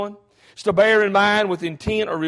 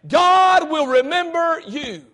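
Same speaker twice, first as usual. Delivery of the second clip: preaching climax; 7 semitones higher, slower than usual. Acted. Preacher shouts the promise of heaven to the congregation. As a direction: bring it home to the church. Repeats climax after amen.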